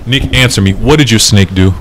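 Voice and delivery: insistent demand. Acted. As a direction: demand an answer forcefully. Player demands an answer, repeating question.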